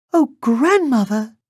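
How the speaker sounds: put-on very high, loud, startled child; gasping little girl. A narrator's reading. Girl sees the disguised wolf, high startled gasp. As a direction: gasp it in a piping girl's voice. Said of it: "she gasped".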